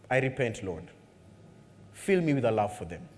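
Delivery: low, soft repentant plea; repentant prayer. Retold or imitated. Believer quietly repenting before God. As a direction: pray it softly, asking for love. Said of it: "the prayer is".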